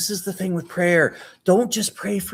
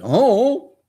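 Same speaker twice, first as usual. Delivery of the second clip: mocking retort; 3 semitones higher, 5 dB louder than usual. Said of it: "Nehemiah could have said".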